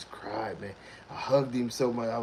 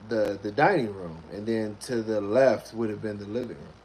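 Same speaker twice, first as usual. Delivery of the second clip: usual speaking voice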